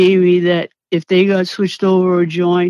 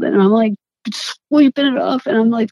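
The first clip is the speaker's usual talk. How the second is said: usual speaking voice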